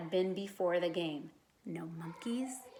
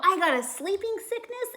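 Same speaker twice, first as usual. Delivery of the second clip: excited; 11 semitones higher, 4 dB louder than usual. A narrator's reading. Excited child telling adults.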